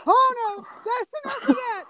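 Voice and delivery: high-pitched mock alarm; flustered alarm. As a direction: imitate a hasty, embarrassed shutdown. Character suddenly realizes what is on TV.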